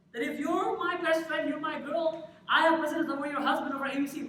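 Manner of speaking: high, loud mock declaration; mock insistent friend. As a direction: proclaim the bro code loudly, hammed-up. Acts out a friend's made-up code.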